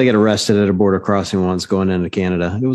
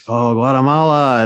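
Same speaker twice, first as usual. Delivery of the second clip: Miguel; 3 semitones higher than usual. Retold or imitated. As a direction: Guatemalan man's accented admission. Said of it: "That's exactly his accent".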